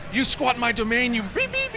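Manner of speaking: furious screamed accusation; retold screaming. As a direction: shriek it like an enraged rock star. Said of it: "he screamed at me".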